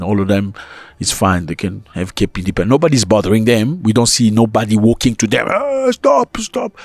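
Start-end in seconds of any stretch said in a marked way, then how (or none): 5.5-6.8 high, far mock cry of stop; mock protester. Vlogger mocking anti-foreigner marchers, high mock shout.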